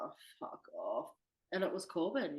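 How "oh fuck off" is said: groggy grumble. Retold or imitated. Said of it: "I was like".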